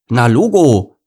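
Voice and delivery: put-on loud, high kid retort; cocky lion cub. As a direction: fire back like a sure-of-himself boy. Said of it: "erwiderte Lars".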